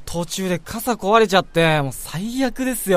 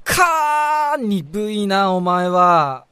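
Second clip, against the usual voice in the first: exasperated; 3 semitones higher, 5 dB louder, much slower than usual. Acted. Teasing the slow colleague.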